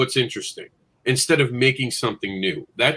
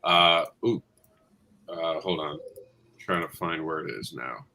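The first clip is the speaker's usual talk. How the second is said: lowered, filtered announcer voice; 7 semitones lower, 6 dB quieter than usual. Real, not acted. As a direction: voice changer set deep. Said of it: "lets me be the announcer voice".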